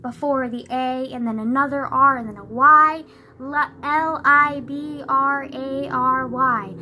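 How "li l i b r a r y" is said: slow, louder letter-by-letter spelling. Shown as a demonstration. Correcting people who drop the R, emphatic spelling out. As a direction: spell each letter slowly and firmly. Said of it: "It literally has an R".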